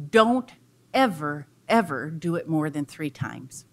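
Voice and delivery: loud firm DON'T, then stressed ever ever; a bird's firm warning to the coyote; stern warning. Acted. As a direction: lay down the rule hard. Bird warns coyote firmly.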